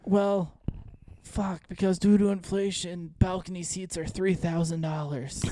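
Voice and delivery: put-on high, slow, grumpy old man. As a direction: grouse about prices like a retiree. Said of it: "you like my old man voice".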